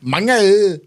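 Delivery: put-on very high, bouncy Vietnamese accent. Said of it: "It's bouncy".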